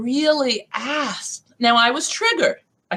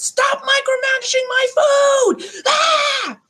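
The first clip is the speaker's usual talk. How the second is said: retold furious shout; slower than usual. Storming out at her micromanaging ex, furious shout. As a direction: blow up at him and storm out. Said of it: "I stormed out of the house".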